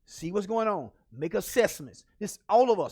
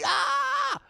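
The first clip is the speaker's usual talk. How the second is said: high-pitched drawn-out "Ah!"; mock frustrated scream; 3 dB louder than usual. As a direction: scream as if overwhelmed, hammed up. Acting out overwhelming emotions.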